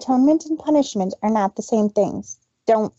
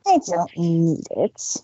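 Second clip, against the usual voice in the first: glaring; 5 semitones lower, slower than usual. A narrator's reading. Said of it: "She stated with a glare".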